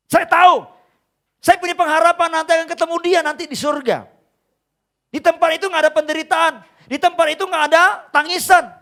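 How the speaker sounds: loud, high preaching voice; preaching at full force. Acted. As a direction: proclaim the hope of heaven to the congregation. Preaching climax.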